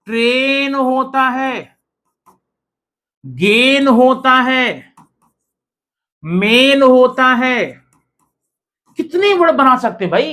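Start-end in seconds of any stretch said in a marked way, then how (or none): 0.1-7.7 ट्रेन, गेन, मेन with long held ए, very slow; drawn-out examples. Shown as a demonstration. Teacher listing rhyming examples, slow chanted drawl. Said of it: "ऐसे क्या और होता है?".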